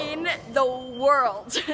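"In the world." said slowly with weight on every word; emphatic, slow. Shown as a demonstration. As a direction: stretch "In the world." slowly and firmly. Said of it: "In all caps".

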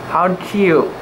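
ordinary delivery, nothing marked

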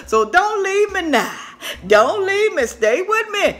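playful pleading. Real, not acted. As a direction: plead with the viewers. Pleading with viewers.

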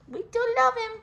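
high, sing-song to the dog; high pet voice. Real, not acted. Owner cooing "We do love him" high. Reassuring the dog, first take.

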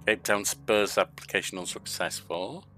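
ordinary talk

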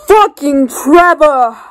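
cursing loudly, slow and drawn out; angry outburst. Real, not acted. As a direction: blurt out the curse in frustration. Angry outburst at a film character.

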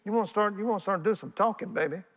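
high, playful needling question; a touch of mock provocation; teasing challenge. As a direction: poke the crowd with a grin. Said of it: "don't look at me out there".

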